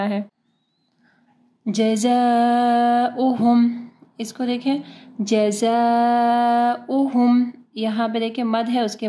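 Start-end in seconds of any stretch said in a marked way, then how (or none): 1.7-7.5 जज़ाउहुम with the zaa stretched very long; slow chant, holding the vowel before the hamza in जज़ाउहुम; long madd. Shown as a demonstration. Teacher shows students the stretch, long madd. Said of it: "चार से पाँच हरकत के बराबर इसको लंबा करना है".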